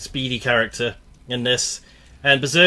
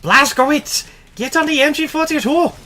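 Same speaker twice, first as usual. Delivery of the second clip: put-on German accent; 6 dB louder than usual. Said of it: "I do not know how to do a German accent".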